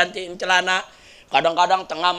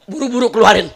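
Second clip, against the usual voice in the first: exasperated jinn; 4 semitones higher, 8 dB louder, faster than usual. Acted. Fed-up jinn begs them out.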